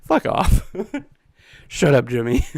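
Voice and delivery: loud, high laughing dismissal; cackling tell-off to a friend; laughing, telling Jimmy off; laughing outburst. Real, not acted. Laughing at a chat meme.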